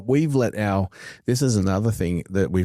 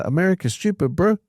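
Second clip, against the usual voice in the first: high, mock Kiwi voice of a friend; a friend's Kiwi accent, imitated; 11 semitones higher than usual. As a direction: quote your mate in a Kiwi twang. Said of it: "it sounds like a Kiwi accent".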